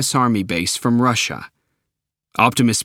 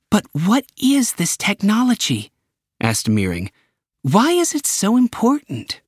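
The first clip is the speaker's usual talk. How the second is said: high pressing questions; curious director; 10 semitones higher than usual. Acted. Meering pressing Optimus, high and urgent. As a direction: push with questions, higher.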